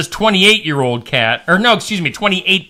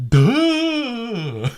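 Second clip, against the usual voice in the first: French duh; 6 semitones higher, 5 dB quieter than usual. Pretend. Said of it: "That's my French duh".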